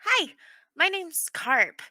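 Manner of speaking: put-on high, loud eager child; little boy voice. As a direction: blurt it like an excited kid. Little boy introduces himself.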